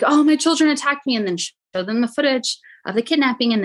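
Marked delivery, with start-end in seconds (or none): 0.0-1.1 high, mocking fake-victim voice; mother faking distress to police, mocking. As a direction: parody the mother playing the victim. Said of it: "and be like".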